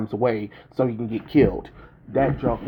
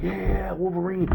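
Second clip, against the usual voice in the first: high, excited cheer for the hero; cheering crowd; 9 semitones higher than usual. Retold or imitated. Theater crowd cheering Wolverine's return, high excited whoop. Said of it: "everybody in the theater was like".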